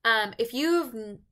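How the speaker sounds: ordinary delivery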